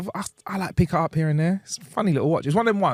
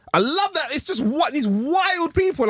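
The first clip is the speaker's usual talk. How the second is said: high-pitched, drawn-out delight; excited gushing. Real, not acted. Fan gushes over wild documentary people, excited. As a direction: gush about it, voice shooting up.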